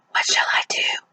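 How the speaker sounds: breathy, voiceless rabbit whisper. Acted. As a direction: whisper it softly. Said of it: "he whispered".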